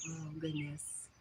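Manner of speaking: normal talking voice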